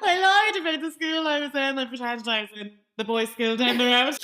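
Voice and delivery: high, loud, barely holding back laughter; laughing confession. Real, not acted. Confessing to co-host about the school lie, cracking up. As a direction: wail it through real laughter.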